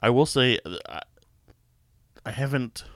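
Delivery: ordinary delivery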